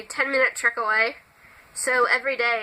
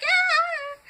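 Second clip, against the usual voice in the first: very high, drawn-out mock squeal; startled squeak. Vlogger acting being poked in the back, high startled squeak. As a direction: imitate a squeak of surprise. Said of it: "it'll just, like, squeak".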